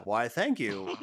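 low, polite mock thanks; puppet grandma reply.

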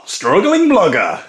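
loud, high, drawn-out announcer shout; mock title announcement. Host announcing made-up ASMR show, mock fanfare voice. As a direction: blare the title slowly with rising flourish.

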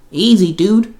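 quick sharp rebuke to a friend; snapping. A narrator's reading. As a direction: cut him off, irritated. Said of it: "Hayden snapped at him".

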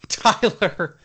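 ordinary talk, nothing marked

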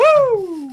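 high, loud whoop; celebratory whoop. Real, not acted. Host celebrates after the group huzzah, high whooping cheer. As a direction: let out a big cheering woo.

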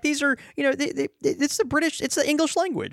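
a high, fast mocking squeak; mock squeaky voice. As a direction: tease him in a squeaky voice.

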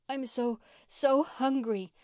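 stretched, slow hungry wail; a starving girl half-wailing her words, slowly; mild wail. Acted. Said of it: "wailed Mary".

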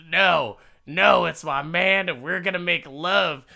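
hammed-up defiant outburst; defiant insistence. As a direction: insist like a stubborn lovestruck woman. Acted woman defiantly insisting.

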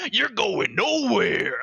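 slow, high wrestling villain taunt; hammed-up wrestler taunt. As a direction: drag it out like a WWE heel. WWE villain line.

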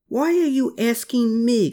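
high-pitched, grumbling protest. A narrator's reading. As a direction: grumble back, pitched well up. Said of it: "grumbled Klaus".

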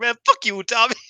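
high, loud furious yell at a rival; imitating a bitter loser yelling at her opponent; mock angry outburst. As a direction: spit it at her like a sore loser. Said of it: "she's like".

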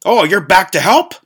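high, loud, mocking question; creepy ogre. Ogre on the stairs asks if he came back to help, mocking. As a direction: tease the fleeing guest cheerfully. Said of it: "And he's like".

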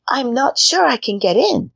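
put-on high little girl voice. A narrator's reading. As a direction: play the doubtful little girl. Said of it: "said Gretel".

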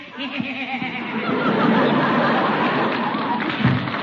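long gloating chuckle; smug chuckle. Acted. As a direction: laugh on the way out the door. Judge leaves with Paula, chuckling.

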